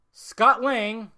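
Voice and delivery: ordinary talk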